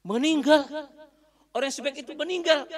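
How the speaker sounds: high, loud shocked disbelief; shocked crying. Acted. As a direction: cry out in disbelief at the news. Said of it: "Nangis, Ustaz, nangis". (pengemis kaget dan menangis mendengar kabar)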